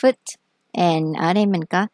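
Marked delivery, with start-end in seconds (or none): 0.0-0.4 Foot with a short vowel, said higher and louder; correct pronunciation. Shown as a demonstration. Teacher corrects students' Foot, short vowel, said high and loud. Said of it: "u ngắn thì đọc hơi ư ha".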